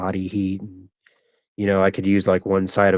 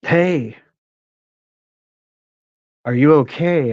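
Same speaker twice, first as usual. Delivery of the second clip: retold call from a car window, higher and a bit louder; a light impression of a driver calling from his car; 6 semitones higher, 5 dB louder than usual. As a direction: call out to a stranger lying by the tracks. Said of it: "he calls out to me".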